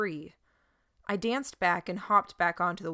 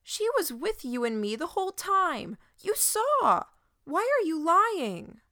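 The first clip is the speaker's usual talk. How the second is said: high, loud accusing outrage; boy accusing the shepherd before the flock, outraged; 10 semitones higher, 4 dB louder than usual. A narrator's reading. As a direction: demand an answer with outrage.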